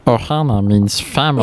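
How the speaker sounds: put-on cartoon alien voice; Stitch. Podcaster shows off a cartoon impression, alien creature voice. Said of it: "I can do Stitch".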